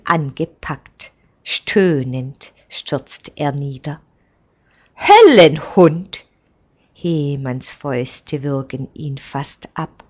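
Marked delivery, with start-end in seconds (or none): 5.0-6.3 loud angry outburst at the attacker; furious cry. A narrator's reading. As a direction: hurl the insult at him. Servant attacks the messenger. (wütender Aufschrei)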